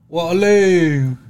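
long, high, drawn-out holler; loud yell. Acted. Yelling out loud on the London underground, long holler. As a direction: belt it out, stretching the last vowel. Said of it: "this man's just yelling".

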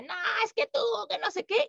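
hammed-up angry retort; mock angry outburst. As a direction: imitate someone snapping back in anger. Retold angry outburst example.

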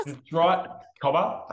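high, loud hammed-up Aussie twang; mock Australian. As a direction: play a cheerful Australian saying cobber. Joining in the Australian bit.

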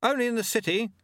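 high call above the slipstream; shouted. A narrator's reading. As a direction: pitch it high to carry over the wind. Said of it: "Lobsang shouted above the slipstream".

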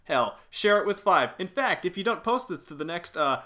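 hammed-up excited ad read; mock podcast plug. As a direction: oversell the five-star review like a promo. Hyped podcast plug parody.

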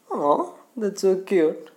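high, drawn-out "aww, so cute" gush; gushing girls. Retold or imitated. Girls cooing over a crying puppy, gushing. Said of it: "girls and all will say".

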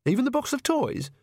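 very high-pitched disbelieving query; exasperated astonishment; incredulous. Acted. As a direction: query it with voice shooting up. Disbelief at the packing list.

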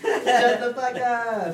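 high squeaky laughing chipmunk voice; helium-high laughing. Real, not acted. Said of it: "You sound insane".

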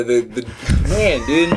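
ordinary talk, nothing marked

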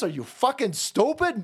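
ordinary delivery

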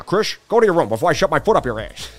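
ordinary talk

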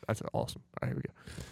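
hushed whisper before the call. Real, not acted. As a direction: whisper so the call won't hear.

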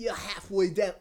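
loud, high mock-English accent; hammy English accent; put-on English accent. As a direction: answer in the fake English accent. Continues the put-on accent, workshop remark.

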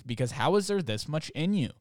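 ordinary delivery

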